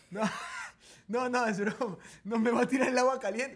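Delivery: grito entre risas, mucho más alto y agudo; riendo a gritos. Real, not acted. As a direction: desmiente la broma riéndose y gritando. Bromea sobre su esposa y se ríe. (se ríe a gritos al decir que es broma)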